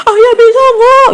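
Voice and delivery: high, loud, slowed tearful yell at husband; mock the wife yelling through tears; crying shout. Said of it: "sambil nangis, sambil teriak". (teriakan tinggi sambil pura-pura menangis)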